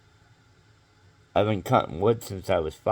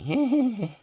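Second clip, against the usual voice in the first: embarrassed squeak; 3 dB louder than usual. Shown as a demonstration. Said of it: "And not be like".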